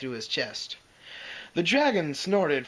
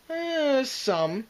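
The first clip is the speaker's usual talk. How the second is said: wearily; 11 semitones higher than usual. A narrator's reading. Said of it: "said Spike wearily".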